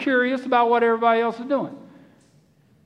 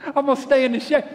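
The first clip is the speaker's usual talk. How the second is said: a touch higher, stubborn; lazy Israelite; 4 semitones higher, faster than usual. Pretend. An Israelite refusing to leave the shade, lightly voiced. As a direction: dig in your heels.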